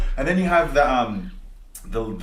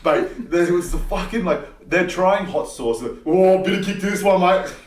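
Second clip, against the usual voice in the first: gym bloke voice; 6 semitones higher, 6 dB louder, slower than usual. Retold or imitated. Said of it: "then they're like".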